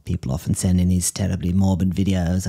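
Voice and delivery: ordinary talk, nothing marked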